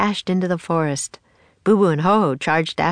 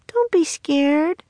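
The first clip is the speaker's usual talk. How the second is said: higher, slow and gentle comfort; reassuring; 11 semitones higher, slower than usual. Acted. Annie comforts scared gorilla.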